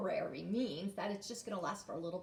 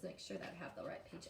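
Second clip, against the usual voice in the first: usual speaking voice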